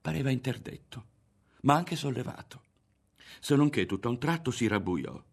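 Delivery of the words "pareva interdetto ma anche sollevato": ordinary delivery, nothing marked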